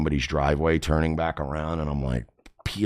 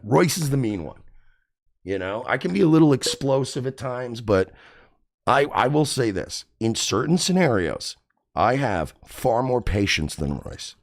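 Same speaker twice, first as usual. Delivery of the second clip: ordinary delivery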